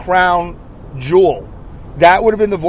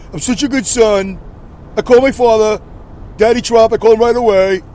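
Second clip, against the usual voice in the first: a light Trump imitation pitched higher and bragging; 5 semitones higher than usual. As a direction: puff up and boast like Trump, only lightly. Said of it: "I'm not gonna do it in my Trump voice".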